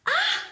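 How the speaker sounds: high loud shriek of fright; a sudden shriek at the mouse. Acted. The cook shrieks on spotting a mouse. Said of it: "said cook".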